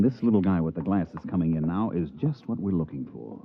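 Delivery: normal talking voice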